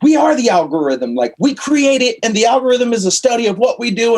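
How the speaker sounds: high, indignant insistence; heated protest. Retold or imitated. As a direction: argue back with rising heat. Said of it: "I'd get so angry and so pissed".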